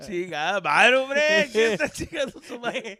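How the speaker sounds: loud, high, laughing Spanish rant; mock Spanish cursing. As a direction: rant in Spanish like an angry parent. Mock Mexican parent cursing.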